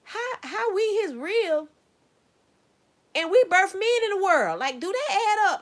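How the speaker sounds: high and loud, disbelieving questions; incredulous. Retold or imitated. Arguing the rib story to viewers, high and incredulous. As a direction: demand an answer, baffled. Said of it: "I'm like".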